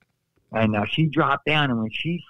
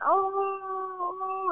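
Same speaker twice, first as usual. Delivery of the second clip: high squealed "Oh!" dropping softer; falsetto cry; 5 dB quieter than usual. Retold or imitated. Falling onto his side in the ring, a softer falsetto cry. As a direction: let the falsetto cry fade as he falls.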